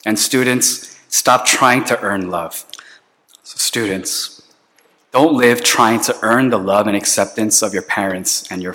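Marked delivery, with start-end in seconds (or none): 3.4-4.4 whisper. Real, not acted. Pastor turns to the students.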